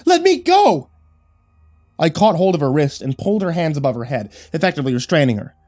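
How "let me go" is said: high, loud frantic cries; frantic shouting. A narrator's reading. Wife slapping at husband's hands, frantic shouting. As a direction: shout as if fighting free. Wife fights being held down.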